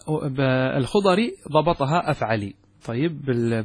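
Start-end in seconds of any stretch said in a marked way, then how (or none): 2.1-2.5 "أفعلي" ending in a short i; kasra ending. Shown as a demonstration. Teacher showing students al-Khudari's vowelling, "أفعلي" ending in kasra. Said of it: "بالكسر".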